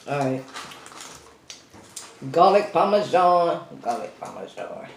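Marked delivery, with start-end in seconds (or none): 3.8-4.9 low murmured repeat. Shown as a demonstration. To viewers, repeating the flavor low and soft. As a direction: lower and much softer, savoring it.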